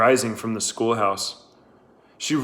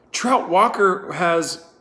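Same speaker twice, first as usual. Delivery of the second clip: high, slow, pleading; urgent pleading; 7 semitones higher, 3 dB louder, slower than usual. A narrator's reading. Catherine cut off urgently.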